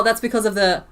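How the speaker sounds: ordinary delivery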